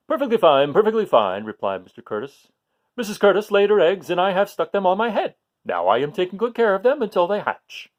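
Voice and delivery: loud, chirpy, high first word; bubbly fish bragging about his eggs; cheerful Mister Curtis. Acted. Reading aloud, the nursery fish father answers loudly and cheerfully.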